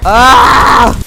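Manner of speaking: a sudden high loud yelp of shock; startled scream. Real, not acted. Player sees her Sim age up, startled high scream. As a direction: cry out at the screen in alarm. Sims turns elder, shocked.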